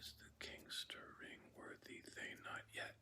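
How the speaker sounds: quiet whispered syllable count; whispered scansion. Shown as a demonstration.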